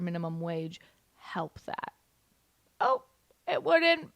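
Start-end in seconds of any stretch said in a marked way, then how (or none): 0.0-1.9 whispered aside. Real, not acted. Mocking rhetorical question, trailing off.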